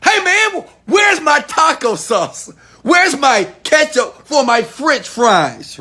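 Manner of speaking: high, loud, slow whiny complaint; mock-indignant squeal about missing taco sauce; whiny customer. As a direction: whine it up high like a petty customer at the counter. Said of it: "And you like".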